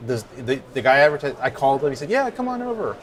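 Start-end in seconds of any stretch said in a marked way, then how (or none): 2.1-3.0 high-pitched, easygoing phone invitation; higher-voiced, welcoming stranger; friendly seller. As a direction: imitate a nice guy inviting someone over. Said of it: "sounded like a nice person".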